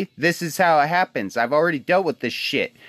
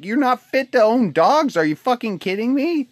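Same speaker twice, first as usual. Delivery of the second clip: raised, higher indignant retort at the owner; angry retort; 7 semitones higher than usual. Retold or imitated. Ranting vlogger to the dog owner, indignant raised voice. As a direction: fire it back like you can't believe her.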